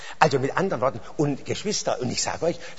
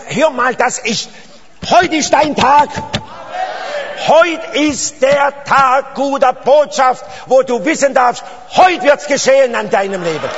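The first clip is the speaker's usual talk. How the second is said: loud, high proclamation, hammering each phrase; full-force revival preacher; impassioned preaching; 10 semitones higher, 7 dB louder than usual. Real, not acted. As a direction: cry out the good news to the congregation.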